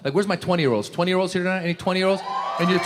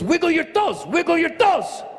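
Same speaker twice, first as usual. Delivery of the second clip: high mock Mexican-accent command; Mexican referee; 11 semitones higher than usual. Referee ordering the injured player, high Mexican accent. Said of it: "And then he goes".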